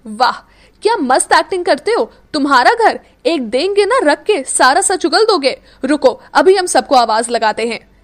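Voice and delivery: loud, sarcastic taunting; scoffing before calling for help; mocking threat. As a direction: jeer at his acting, then threaten. Meera mocks and threatens the boy.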